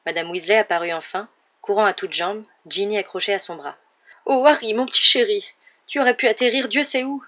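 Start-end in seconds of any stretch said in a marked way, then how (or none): 4.3-7.2 high, loud, worried motherly voice; fussing mother. A narrator's reading. Mrs Weasley runs up to Harry, fretting loudly. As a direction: gush over a lost child just found.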